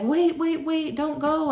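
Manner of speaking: high-pitched imitation of urgent pleading; retold urgent calling. Staff running down hall calling out.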